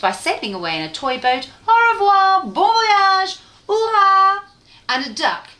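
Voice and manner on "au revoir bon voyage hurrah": high, loud, sing-song goodbye cheer; cheery farewell. Acted. French fries calling goodbye from their toy boat, a high sung cheer. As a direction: wave them off with a bright sung hurrah.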